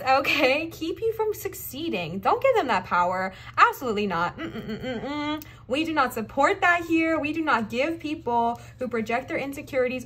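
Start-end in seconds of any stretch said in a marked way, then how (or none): 4.4-5.4 playful humming refusal; laughing hum no. Real, not acted.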